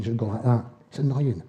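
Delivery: normal talking voice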